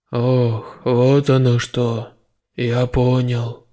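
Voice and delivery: low, slow realization; slow low general. A narrator's reading. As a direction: dawn on him slowly.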